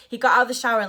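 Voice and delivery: ordinary talk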